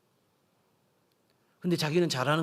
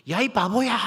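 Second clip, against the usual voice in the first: loud, high-pitched yell of insult; mock shouted taunt; 8 semitones higher, 7 dB louder than usual. Child shouting an insult at the mountain, mock yell. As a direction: holler it out towards a mountain, play-acting.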